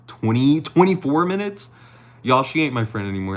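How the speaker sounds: high, loud mock outrage; outraged tease. Real, not acted. Scolding late friend Ari in chat, mock-outraged. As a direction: exaggerate the disbelief at the lateness.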